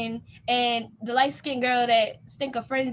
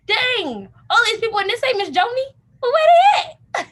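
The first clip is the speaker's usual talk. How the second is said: exasperated disbelief; 9 semitones higher, 6 dB louder than usual. Retold or imitated. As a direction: cry out in comic disbelief. Surprised at crowd, not Joni.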